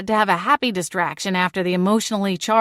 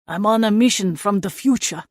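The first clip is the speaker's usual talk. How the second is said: lightly done fake Austrian action-hero accent; a hint of a fake Austrian movie hero. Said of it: "with an obviously fake accent".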